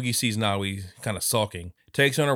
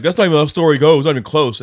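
angry; 6 semitones higher, 12 dB louder, faster than usual. Retold or imitated. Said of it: "When dude was like".

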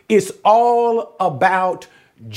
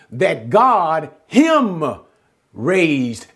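that God HIM raised, slowly, HIM leaned on; stress on him; much slower than usual. Shown as a demonstration. Teacher to class, slow stress on HIM. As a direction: spell out the Greek order slowly, lean on HIM. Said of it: "slowing it down".

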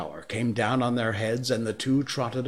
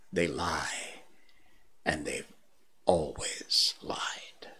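softer, slow scornful hiss of a panther; quiet scorn; 6 semitones lower, 6 dB quieter, slower than usual. Acted. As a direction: dismiss the liars in a low hush. Bagheera's scornful verdict.

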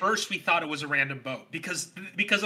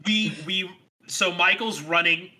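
ordinary delivery

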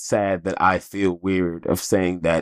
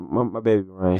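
usual speaking voice

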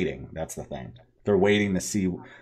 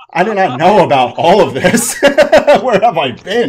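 higher and louder through laughter; laughing; 7 semitones higher, 9 dB louder, slower than usual. Retold or imitated. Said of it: "Jamie's like".

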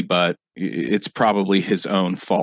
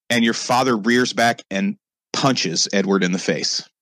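ordinary delivery

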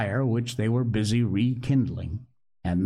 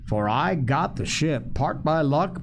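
usual speaking voice

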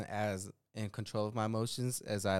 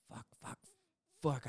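barely audible whispered curse; whispered dread; 3 semitones higher, 8 dB quieter than usual. Retold or imitated. Husband realizes he tossed the receipt, whispered dread. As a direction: swear under your breath.

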